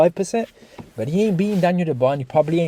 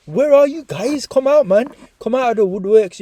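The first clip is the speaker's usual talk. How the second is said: calling them out; 8 semitones higher, 3 dB louder than usual. Pretend. YouTuber to vanished commenters, calling them out. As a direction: summon them out of hiding.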